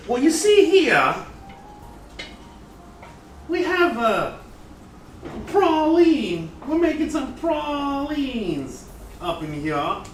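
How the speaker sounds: high, slow New Orleans Southern drawl; Southern chef showing off pralines, hammed-up New Orleans drawl; New Orleans Southern accent. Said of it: "some New Orleans cuisine".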